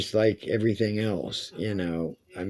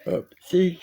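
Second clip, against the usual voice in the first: yawning; 9 semitones higher, 4 dB louder than usual. Real, not acted. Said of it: "excuse me, yawning".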